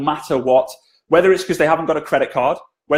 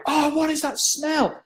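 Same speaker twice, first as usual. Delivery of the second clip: high, slow, soft disgusted groan; disgusted coworkers; 11 semitones higher, 5 dB quieter, slower than usual. Retold or imitated. Office staff reacting to the smell, high and slow. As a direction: whine it out in disgust. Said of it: "they're also like".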